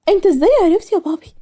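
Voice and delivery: high-pitched astonished question; acted surprise, voice jumping up; shocked. A narrator's reading. Daughter learns her father knew about the suitor, shocked. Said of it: "بصيت له ملاك بصدمة ومش مصدقة".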